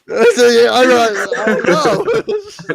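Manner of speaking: high, loud squeal of laughing words; helpless laughter. Real, not acted. Co-host unable to settle the pronunciation, cracking up. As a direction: barely get it out for laughing. Cracking up, cannot answer the question.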